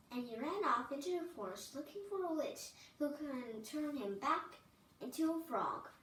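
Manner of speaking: ordinary delivery, nothing marked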